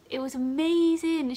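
high, loud burst of wonder; amazed. Real, not acted. Student nurse remembered by her patient, real amazement. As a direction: let amazement lift the voice high.

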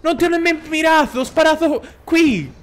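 indignant shouting. Real, not acted. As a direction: shout your defence, stretching the key words. Protesting about a shot he fired.

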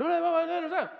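loud high repeated no; a fire marshal yelling to hold the crowd; shouting no. Retold or imitated. Fire marshal shouting at the crowd pushing the door.

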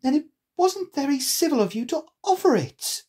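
put-on high-pitched cross girl; indignant storybook heroine; angry. A narrator's reading. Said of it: "said Alice angrily".

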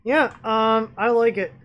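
talking around a screw in mouth; words blocked by something held in mouth; mouth full. Real, not acted. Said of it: "sorry, I got a screw in my mouth".

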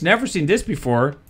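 normal talking voice

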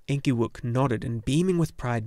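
ordinary delivery

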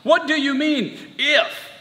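high, challenging disbelief; Jesus's incredulous question. Acted. Preacher voicing Jesus challenging the father, incredulous. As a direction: lift the pitch on the whole question. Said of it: "Jesus is just like".

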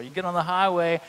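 normal talking voice

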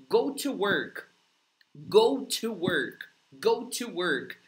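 slow, careful go to work for students to repeat; teacher modelling at half speed; slow model repetition. Shown as a demonstration. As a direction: say go to work slowly and clearly, word by word. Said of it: "Vai repetindo aí comigo".

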